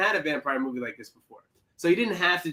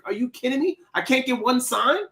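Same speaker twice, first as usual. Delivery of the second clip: incredulous disbelief; 7 semitones higher than usual. Retold or imitated. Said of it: "I was like".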